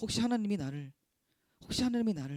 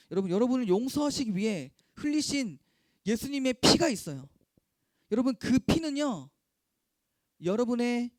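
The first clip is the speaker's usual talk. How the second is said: loud, rising preacher's call; fervent preaching; 5 semitones higher, 6 dB louder than usual. Acted. Pastor urging students to trust forgiveness, loud fervent preaching. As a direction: preach it with full force to the youth.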